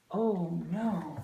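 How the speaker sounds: plain reading voice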